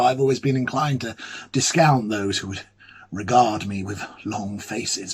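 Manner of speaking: ordinary delivery, nothing marked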